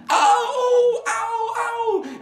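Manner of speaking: very high, loud ows; yelping in pain. Acted. The kicked thief yelps high in pain. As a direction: yelp each ow like a kicked man.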